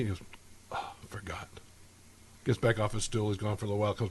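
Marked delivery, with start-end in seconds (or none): 0.7-1.5 whispered under the breath, sheepish. Acted. Drunk at the bar whispering his sheepish admission. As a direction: hush it like a guilty confession.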